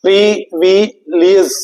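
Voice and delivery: Privilege in three slow, separated syllables. Shown as a demonstration. Teacher showing students the spelling by sound, syllable by syllable. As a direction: break privilege slowly into its sounds, pausing between each. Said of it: "syllable के base पे याद करें".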